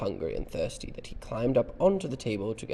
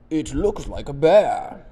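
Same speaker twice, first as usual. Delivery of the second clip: loud, drawn-out exclamation, higher; Mr Brown in storybook; 5 semitones higher, 13 dB louder than usual. Acted. Parent reads Mr Brown's sighting to children, exclaiming. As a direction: call it out in loud surprise.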